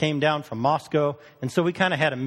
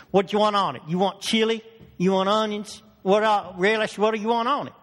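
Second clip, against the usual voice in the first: higher, quick-fire questions from a diner cook; pushy counter guy; 6 semitones higher than usual. Retold or imitated. Diner cook rattling off toppings at the Yankee, higher and insistent. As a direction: pepper him with the toppings like a busy short-order cook.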